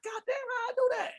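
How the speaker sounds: very high, soft squeal; amazed grandma. Retold or imitated. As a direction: imitate an astonished grandma in falsetto. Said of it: "She was like".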